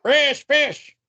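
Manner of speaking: high shouted catchphrase; loud callout. Acted. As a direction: call it out like a fishmonger. Calling out a catchphrase.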